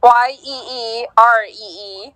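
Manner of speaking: ordinary talk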